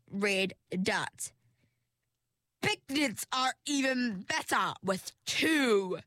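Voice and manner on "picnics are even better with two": high, slow, sinister purr; sinister villain. A narrator's reading. Perdita threatening Ringlet, slow and high sinister. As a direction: promise no pain with relish. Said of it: "she said".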